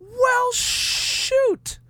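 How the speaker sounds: high falsetto aw-shucks; mock aw-shucks. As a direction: squeak out a bashful thanks. Touched by fan praise.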